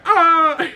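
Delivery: short high cry of pain, hammed-up; mock pained yelp. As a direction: yelp as if jabbed in the ribs. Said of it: "He's like".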